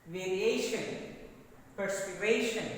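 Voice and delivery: "Variation", "Perspiration" punched on the A before "tion"; stress on second-last syllable. Shown as a demonstration. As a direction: drill them as vari-A-tion, perspi-RA-tion. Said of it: "Stress is on second syllable".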